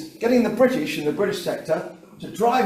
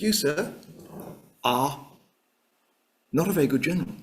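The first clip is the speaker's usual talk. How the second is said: halting, with weighty pauses; pointed accusation; 3 dB quieter than usual. Acted. Clark confronting his superior Alexander in his office, pointed and halting.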